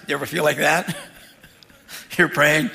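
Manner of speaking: normal talking voice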